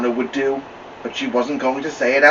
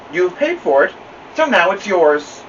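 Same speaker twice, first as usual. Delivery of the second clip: amused librarian; 6 semitones higher, 4 dB louder than usual. Acted. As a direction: draw it out like a librarian playing along.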